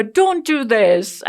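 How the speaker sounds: slow, loud mock plea; imitating the boys begging; kids' loud complaint. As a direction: drag out don't do this like a teenager. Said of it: "my boys will always say".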